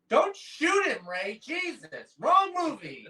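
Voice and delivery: high, loud joking yell at the screen; mock panicked shout. Joking at the screen about Ray Liotta, a loud mock yell. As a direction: shout a warning at the movie like a fan. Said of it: "I'm like".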